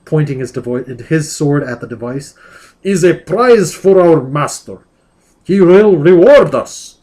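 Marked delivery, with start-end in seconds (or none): 2.8-7.0 slow and looming; menacing demon. Acted. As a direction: growl it out like a demon warrior. Demon Alpha voiced in reading.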